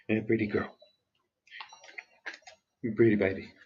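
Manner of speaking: soft whispered cooing to a pet; hushed sweet talk to a dog. Real, not acted.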